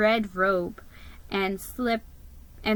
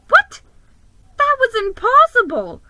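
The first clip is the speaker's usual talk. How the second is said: much higher and louder, disbelieving; shocked gasp; 14 semitones higher, 10 dB louder than usual. Acted. Mouse hears Santa can't deliver, shocked outburst. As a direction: burst out in alarm. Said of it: "I gasped".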